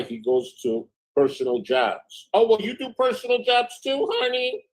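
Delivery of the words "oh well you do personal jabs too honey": much higher, sing-song mock sweetness; mocking sweet retort. Reading her friend's text back in a mocking voice. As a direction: sneer it back sugary and high.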